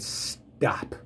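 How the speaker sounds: a soft, whispered stop; whispered command. Retold or imitated. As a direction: hush the storm with a whisper. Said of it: "he said".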